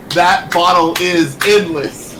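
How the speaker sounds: loud, slow triumphant reveal; dramatic reveal. Acted. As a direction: announce it with relish.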